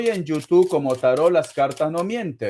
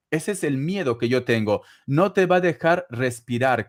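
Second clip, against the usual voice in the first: normal talking voice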